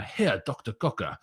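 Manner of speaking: badly faked German accent. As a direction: put on a hammy, terrible German accent. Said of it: "that was my worst German accent".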